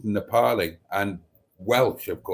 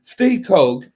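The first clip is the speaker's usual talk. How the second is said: ordinary delivery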